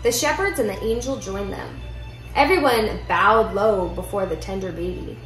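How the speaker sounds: usual speaking voice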